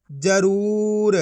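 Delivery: drawn-out syllable blend, very slow; slow blended reading. Shown as a demonstration. Teacher showing children how to blend syllables, drawn out slowly. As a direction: stretch the word out as you join the sounds. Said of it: "मैं किस तरीके से ... पढ़ रहा हूँ".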